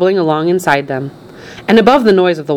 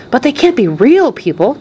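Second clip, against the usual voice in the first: higher, lightly voiced child's disbelief; puzzled boy; 7 semitones higher than usual. A narrator's reading. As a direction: protest like a puzzled young boy. Charlie doubts what he sees.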